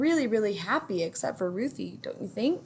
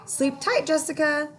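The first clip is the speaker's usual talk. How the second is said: higher, sweet goodnight voice; Ruthie's voice; 7 semitones higher than usual. A narrator's reading. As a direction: tuck in your friend lovingly. Ruthie says goodnight to friend.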